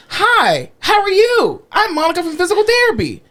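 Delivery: high, sweetly deliberate introduction; exaggerated fake-nice greeting. Therapist on third entrance to patient, fake-nice. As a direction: pour on the sweetness.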